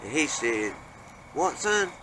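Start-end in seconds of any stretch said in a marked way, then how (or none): 1.3-1.9 pitched-up, puzzled reply; startled question. Retold or imitated. Dad answers his son, startled and pitched high. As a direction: caught off guard, ask it high.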